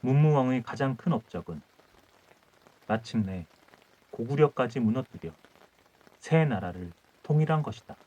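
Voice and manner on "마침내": usual speaking voice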